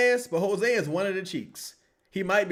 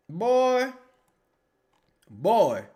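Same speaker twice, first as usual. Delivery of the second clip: usual speaking voice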